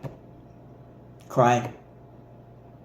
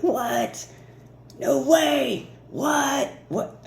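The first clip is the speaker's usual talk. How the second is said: much higher, excited yelps of disbelief; squealing disbelief; 13 semitones higher, much slower than usual. Pretend. To viewers, imagining the big things rolling in: high squeal of shock. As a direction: shriek it like you just won the lottery. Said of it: "You're gonna be like".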